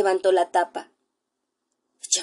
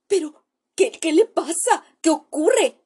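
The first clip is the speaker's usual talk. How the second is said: high, louder alarmed questions; pale with fear; 8 semitones higher, 6 dB louder than usual. Acted. Narrator, pale, asks the doctor what is wrong, high alarmed questions. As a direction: ask in sudden fright what happened. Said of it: "pregunté lívido".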